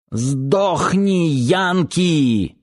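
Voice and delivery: high, sharp hateful yell; hateful shout. A narrator's reading. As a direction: spit it at the enemy. Enemy ambush cry.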